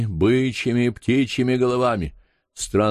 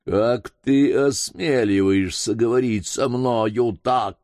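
ordinary delivery, nothing marked

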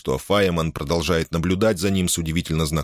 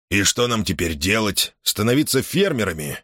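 ordinary delivery, nothing marked

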